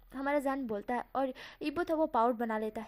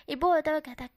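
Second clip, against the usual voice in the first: usual speaking voice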